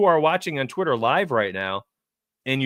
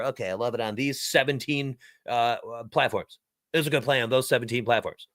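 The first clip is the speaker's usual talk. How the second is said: nerdy nasal know-it-all voice; mock nerd listing platforms; 4 semitones lower, 3 dB quieter than usual. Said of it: "Nice nerd voice".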